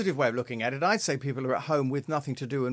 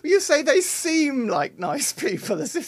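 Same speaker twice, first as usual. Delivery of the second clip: teasing amusement; 9 semitones higher than usual. Real, not acted. Co-host teasing host over his wording, amused. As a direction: rib him about the proviso, laughing.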